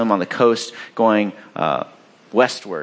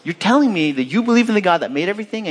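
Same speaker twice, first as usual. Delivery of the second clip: fast, higher-pitched mock disbelief; incredulous; 8 semitones higher, faster than usual. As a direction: repeat the claim back as preposterous. Sailors find Jonah's claim preposterous.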